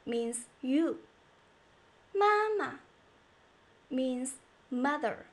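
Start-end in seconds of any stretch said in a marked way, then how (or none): 2.1-2.8 "妈妈" first syllable high and held, second dropping short; light neutral tone. Shown as a demonstration. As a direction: hold 妈 high, then drop the second lightly. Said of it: "repetition of the first one".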